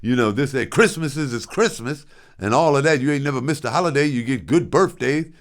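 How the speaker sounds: father scolding his son who demands words of love; lecturing father. Retold or imitated. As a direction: list it off like an offended dad. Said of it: "He said".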